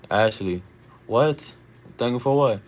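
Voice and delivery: ordinary talk, nothing marked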